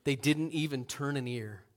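usual speaking voice

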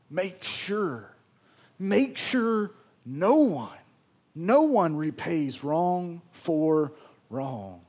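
very slow, every word set apart; slow emphatic. Real, not acted. Preacher stressing Paul's must to the congregation, slow. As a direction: spell the command out slowly.

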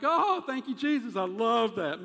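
loud, high burst of delight; elated gratitude. Acted. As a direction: gush thanks at full volume. Acting out delight at blessings.

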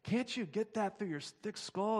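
hammed-up chewing out; mock angry manager. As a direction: scold the waitress like a petty boss. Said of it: "just chewing this waitress out".

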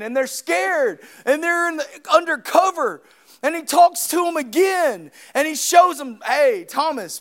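loud, high-pitched sermon delivery; full-force preaching. Acted. Preacher telling church of Peter's condemnation, full-force preaching. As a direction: preach it at a shout, driving hard.

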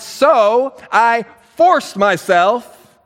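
milk "forced" like a martyr; hammed-up reluctant sacrifice; drawn-out excuse. Saul's excuse, stretched.

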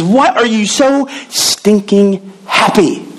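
slow, drawn-out incredulous question; baffled coworker. Retold or imitated. Coworker asking the cheerful jobless teacher, slow incredulous drawl. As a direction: stretch "why" like he can't believe it.

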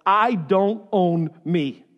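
each word set apart, slowly; slow and deliberate. Shown as a demonstration. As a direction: spell it out word by word, "I don't own me". First take, deliberate statement of truth.

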